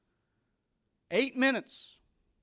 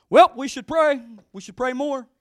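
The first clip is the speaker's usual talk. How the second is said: much louder, higher call to pray; doctor's loud call; 8 semitones higher, 16 dB louder than usual. Retold or imitated. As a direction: boom it out like the doctor rallying the room. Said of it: "he's like".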